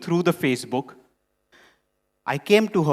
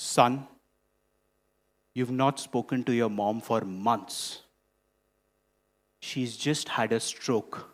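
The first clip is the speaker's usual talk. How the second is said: grave; 5 semitones lower, 5 dB quieter than usual. Retold or imitated. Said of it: "this is how it's read".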